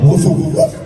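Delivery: high, loud mock barks; the dog joining in on the curfew, barking. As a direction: bark the time like the family dog. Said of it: "Even the dog".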